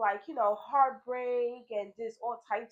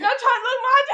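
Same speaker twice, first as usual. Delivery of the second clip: much higher and louder, words dissolving into laughter; loud laughing; 15 dB louder than usual. Real, not acted. Describing her bawling to her friend, laughing loudly. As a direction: crack up mid-sentence. Recalls bawling in church, cracks up.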